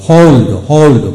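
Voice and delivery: teacher models "হোল্ড"; model pronunciation. Shown as a demonstration. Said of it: "ও উচ্চারিত হলো". (জোরে স্পষ্ট "হোল্ড")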